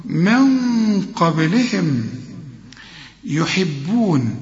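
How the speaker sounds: من قبلهم يحبون drawn very slowly; slow recitation. Shown as a demonstration. Teacher recites slowly for the student. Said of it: "نفخم".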